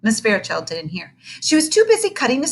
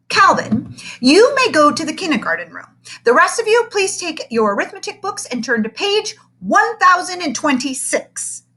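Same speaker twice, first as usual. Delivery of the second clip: bright, higher teacher voice; a cheery classroom teacher, pitched up; 9 semitones higher, 6 dB louder than usual. Acted. Teacher asks class for a helper, pitched up.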